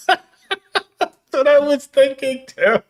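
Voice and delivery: high, slow, laughing hard. Real, not acted. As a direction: laugh through the words. Laughing at dark joke.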